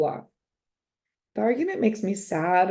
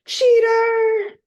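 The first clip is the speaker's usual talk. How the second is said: much higher, louder accusing scream; 8 dB louder than usual. Acted. A girl screams at her friend over a card game. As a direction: scream it at your best friend. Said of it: "one of them is screaming".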